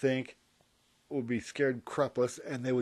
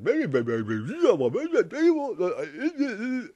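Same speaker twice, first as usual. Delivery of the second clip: alien gibberish; 9 semitones higher, 7 dB louder than usual. Retold or imitated. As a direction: babble like an alien, climbing higher and slowing. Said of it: "Are they hearing".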